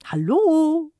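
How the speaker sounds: put-on cheery old neighbour calling over the hedge to the kids; cheerful belted hello. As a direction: belt it out merrily, an old woman's hello. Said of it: "schmetterte sie vergnügt herüber".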